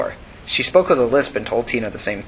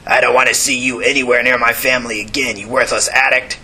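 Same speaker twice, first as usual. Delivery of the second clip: put-on stern older man. The older man's warning.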